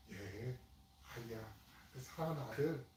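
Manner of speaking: father softly calling his beloved son; tender calling. Retold or imitated. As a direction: call your child home gently. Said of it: "이렇게 부르는 거거든요".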